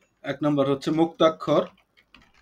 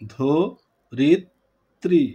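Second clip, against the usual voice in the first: "ধো রিত্রী" slowly as ধো, রিত, ত্রী with pauses; slow syllable breakdown; 3 dB louder, much slower than usual. Shown as a demonstration. Teacher showing the class slow pronunciation, "ধো রিত্রী" syllable by syllable. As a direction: sound out "ধো রিত্রী" in three beats. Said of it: "ধীরে ধীরে উচ্চারণ করব".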